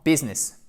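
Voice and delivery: "business" as BIZ-ness; English "business", middle i silent; correct English business. Shown as a demonstration. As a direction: say "business" in two syllables, short i. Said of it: "non pas business ou business".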